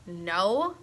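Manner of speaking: ordinary delivery, nothing marked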